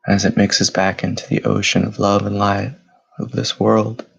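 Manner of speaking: steady, even meditation voice with long pauses; measured, level-toned meditation leader, unhurried between breaths; guided meditation. Acted. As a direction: guide them gently inward, phrase by phrase. Leads guided meditation after bell.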